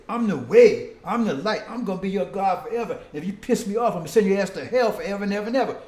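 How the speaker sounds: loud mock tyrant, fast at the end; booming mock threat, rushing the last line; dictator rant. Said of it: "That sound like a dictator to me".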